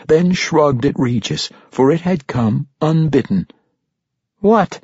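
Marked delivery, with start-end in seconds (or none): 4.4-4.8 high, sudden question; raised, puzzled query; startled question. A narrator's reading. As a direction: ask it in bewilderment. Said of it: "Regis asked".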